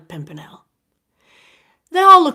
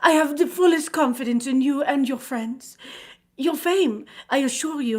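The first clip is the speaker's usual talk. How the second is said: grateful countess; 6 semitones higher, 5 dB louder than usual. A narrator's reading. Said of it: "she replied".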